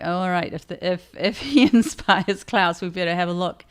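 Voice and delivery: laughing through the quote; amused mock reply. Retelling their own reply, laughing a little. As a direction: chuckle while giving in.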